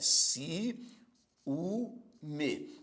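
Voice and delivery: "si, u, me" as separate spaced beats; syllable by syllable. Shown as a demonstration. Teacher showing the class the syllables of ciúme, spaced out. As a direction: pause between "si", "u" and "me". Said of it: "Ó, observe".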